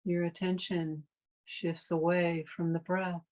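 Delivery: calm, unhurried meditation guide with long pauses; guided meditation. Real, not acted. Meditation teacher leading a class into breath awareness, calm guided meditation. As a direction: guide listeners through the breath in spaced-out, even phrases.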